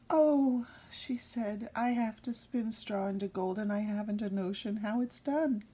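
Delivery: put-on reading a fairy tale to children, unhurried; storyteller voice for a child listener; story narration. A narrator's reading. Reader telling Rumpelstiltskin to a child.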